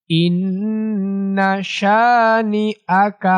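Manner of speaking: slow melodic chant, long vowels held; slow tajweed recitation drill; Quran recitation. Shown as a demonstration. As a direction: chant each phrase for pupils to repeat.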